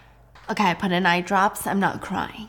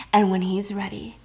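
ordinary talk, nothing marked

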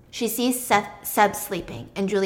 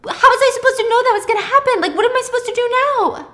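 fast, very high, loud protest; hysterical; 14 semitones higher, 8 dB louder, faster than usual. Acted. Pippa protesting to Thomas she couldn't have known, hysterical. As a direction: frantically defend yourself.

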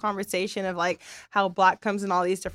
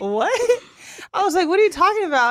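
high and loud, laughing through the words; retelling her own baffled laugh; laughing disbelief; 9 semitones higher, 6 dB louder than usual. Retold or imitated. Mom retells her baffled reaction.

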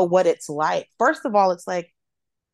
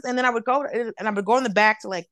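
ordinary delivery

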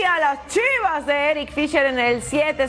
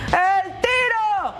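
slow drawn-out shot call; 6 semitones higher, much slower than usual. Acted.

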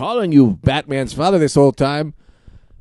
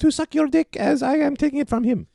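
high woman voice; 12 semitones higher than usual. Acted. Woman character voice held.

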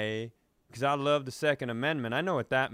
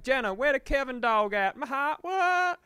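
much higher, louder squeaky repairman voice; repairman impression; 13 semitones higher, 5 dB louder than usual. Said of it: "she hates that impression".

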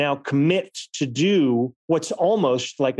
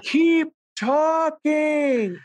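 high, slow, far-off calling voice; slow, stretched call from far away with a laugh in it; distant call; 13 semitones higher, much slower than usual. Retold or imitated. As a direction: call it out from across a huge train station. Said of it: "like someone calling at you from the other end of Grand Central Station".

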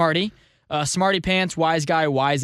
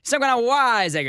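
wise-guy voice; 6 semitones higher than usual. Acted. Host showing off a favourite word, wise-guy voice. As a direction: do a hammed-up wiseacre heckler. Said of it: "just wanted to do the voice".

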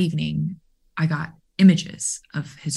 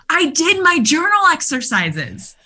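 proud boast; 12 semitones higher, 5 dB louder than usual. Retold or imitated. Player boasting in cafeteria.

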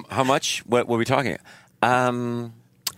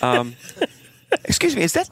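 salesman spotting Santa; 9 semitones higher than usual. Retold or imitated. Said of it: "It's like".

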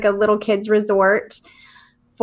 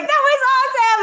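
much higher, laughing delight; excited mock; 13 semitones higher, faster than usual. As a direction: imitate everyone raving. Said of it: "Everybody's like".

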